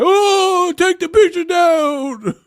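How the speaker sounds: high, loud, slow whiny mock voice; squeaky parody of an upset fan. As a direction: plead it like a mad fan. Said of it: "they gonna get mad".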